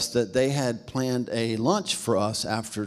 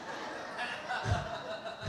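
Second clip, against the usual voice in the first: breathy laugh; 10 semitones higher, 14 dB quieter than usual. Retold or imitated. Kids awed in Times Square.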